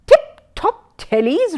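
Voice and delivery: high, slow, disdainful echo; distasteful repeat. A narrator's reading. CID man repeating the card with disdain. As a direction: sniff at the silly name. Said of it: "repeated the C I D man ... distastefully".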